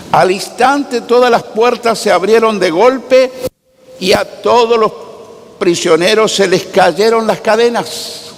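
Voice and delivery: ordinary delivery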